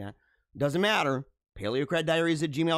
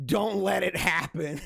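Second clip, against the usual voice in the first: slow echo breaking into laughter; laughing mockery; slower than usual. Host mocking the woman in the clip, laughing. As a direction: repeat it slowly, then crack up.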